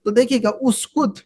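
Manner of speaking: usual speaking voice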